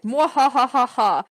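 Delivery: sneering mock villain laugh; evil cackle. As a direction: cackle like a hammy baddie. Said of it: "Tattoo artist is like".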